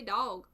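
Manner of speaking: "dog" with an aw vowel; southern pronunciation. Shown as a demonstration. Contrasting own way with northerners, "dog" as dawg. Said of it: "But I say dog".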